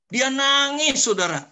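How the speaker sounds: high-pitched, loud outburst of amazement; astonished exclamation. Retold or imitated. Preacher revealing the man's tears to congregation, astonished exclamation. Retells man's unexpected weeping.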